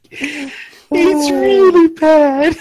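high, slow, drawn-out wail. Acted. Mocking Ghulam's looks.

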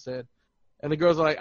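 normal talking voice

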